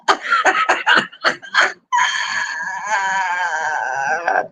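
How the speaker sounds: high ringing laughter; laughing. Real, not acted. Laughing at scaring the little girl.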